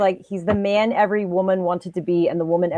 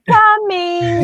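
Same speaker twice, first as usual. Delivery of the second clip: sing-song "Coming" pitched much higher; Frank-N-Furter impression; 11 semitones higher, 4 dB louder than usual. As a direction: call "Coming" to the dog like Frank. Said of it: "I use that line ... I'm like".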